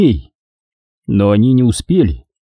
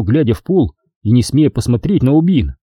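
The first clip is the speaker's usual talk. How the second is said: plain reading voice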